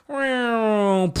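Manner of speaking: long, high descending wail; falling-object whistle. Retold or imitated. Disaster movie object falling onto a car, imitated high falling whine. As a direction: mimic a thing plummeting from the sky. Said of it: "then something goes".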